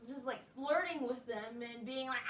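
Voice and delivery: ordinary delivery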